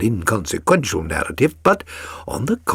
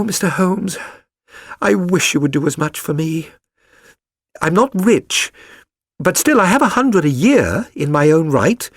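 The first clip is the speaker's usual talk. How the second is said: put-on higher young woman's voice, pleading; Mary Sutherland voiced; 6 semitones higher than usual. A narrator's reading. Mary begging Holmes to find her fiancé, higher and pleading. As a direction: lift the voice for the earnest typist. Reads Mary's plea.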